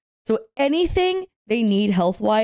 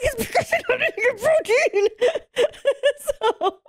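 laughing; 12 semitones higher than usual. Retold or imitated. Laughing retelling to mom.

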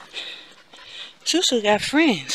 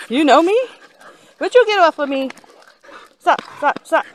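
loud; 8 semitones higher, 10 dB louder than usual. Real, not acted. Dogs jumping on her.

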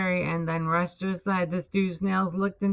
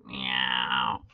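low, short cat-like meow; snail's meow; 3 dB quieter than usual. A narrator's reading. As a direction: answer with a low-pitched meow. Gary the snail answers SpongeBob.

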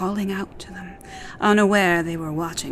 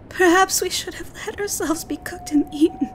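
weeping; 12 semitones higher, faster than usual. Acted. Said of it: "Natsu wept".

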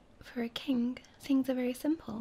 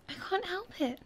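higher, embarrassed little prince; greatly embarrassed; 7 semitones higher than usual. A narrator's reading. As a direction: plead helplessly in a raised voice. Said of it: "with great embarrassment".

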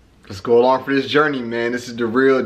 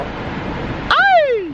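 excited shout. Real, not acted. Celebrating new smile.

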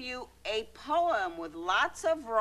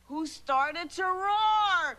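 drawn-out, higher, swelling storytelling; building to a roar; 9 semitones higher, 4 dB louder, much slower than usual. Acted. Mother introduces the lion, dramatically building.

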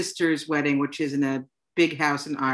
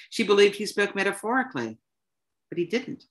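plain reading voice

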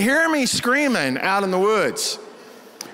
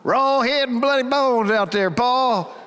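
put-on a Texas country-boy drawl, pitched up like a child; 5 semitones higher, slower than usual. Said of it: "you should have heard me when I was five".

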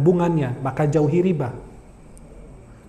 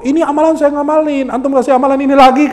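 high, slightly louder glaring objection; mock indignant protest; 7 semitones higher, 4 dB louder than usual. Debtor objecting to being given the same prayer, mock indignant protest. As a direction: protest it high, as a wide-eyed doubter. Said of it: "yang awalnya melotot".

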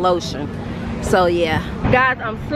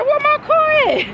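much higher, alarmed cry of disbelief; mock panic. Vlogger retelling finding her parking expired, much higher mock panic. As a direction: panic about the car in a squeak. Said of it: "I said".

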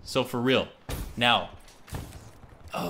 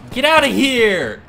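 triumphant yell; 7 semitones higher, 7 dB louder than usual. Real, not acted. Shooting an enemy.

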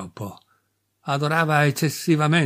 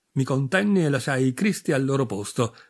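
ordinary delivery

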